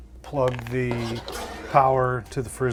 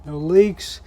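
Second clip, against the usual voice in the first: pleased; 5 semitones higher, 8 dB louder than usual. Real, not acted. Job done, no leaks found.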